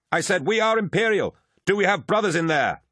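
high, projected battle shout; insistent radio hail. A narrator's reading. Arriving Imperial hailing brothers in the fight, high insistent shout. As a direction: repeat it high and forceful over the din. Repeating a call over the vox.

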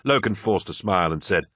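usual speaking voice

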